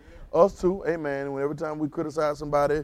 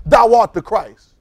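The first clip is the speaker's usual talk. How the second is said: slow, loud, certain proclamation; real absolute; 5 semitones higher, 8 dB louder, slower than usual. Shown as a demonstration. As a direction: declare it, no hesitation. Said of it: "You have to be real absolute".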